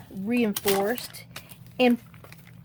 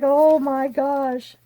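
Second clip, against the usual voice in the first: drawn-out oh my gosh, higher; mock amazed; 6 semitones higher, 6 dB louder, much slower than usual. Hearing the dad cried, slow amazed gasp. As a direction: stretch it slowly in mock wonder. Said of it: "I was like".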